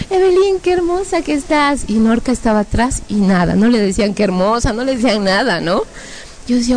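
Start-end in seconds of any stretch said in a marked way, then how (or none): 0.1-1.8 high-pitched mock admiration; gushing relatives. Relatives complimenting the favourite sister, gushing. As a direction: sing the praise high, like a doting aunt. Mimicking uncles praising the sister.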